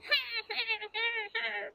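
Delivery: high quacking cackle like a duck; imitated duck laugh. Speaker imitates the laughing duck for the river-watcher. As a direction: mimic the duck's laugh. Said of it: "That literally sounded like".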